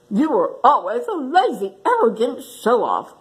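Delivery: squawking vulture. Acted. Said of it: "squawked the vulture".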